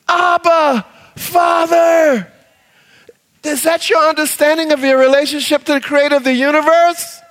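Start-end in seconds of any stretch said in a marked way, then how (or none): 0.1-2.3 shouted, calling to a father; crying out. Shown as a demonstration. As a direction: cry it out boldly. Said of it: "Crying out".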